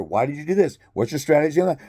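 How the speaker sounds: usual speaking voice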